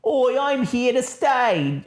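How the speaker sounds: a slow, high put-on Australian "Oi, I'm here to stay"; lightly mocking a wrestler in a bad Australian accent. Mocking a wrestler's promo in a bad Australian accent. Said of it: "All right, that's Australian".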